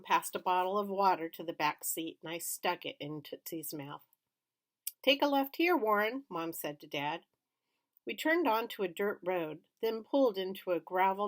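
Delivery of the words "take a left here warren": mom directing. A narrator's reading.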